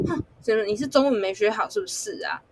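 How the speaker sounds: usual speaking voice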